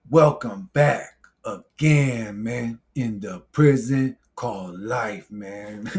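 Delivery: put-on very slow, drawn-out African-accented intro. Vlogger imitating another channel's opening greeting, slow African accent. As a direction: imitate a YouTuber's African accent, word by stretched word. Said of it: "He's got an accent in Africa".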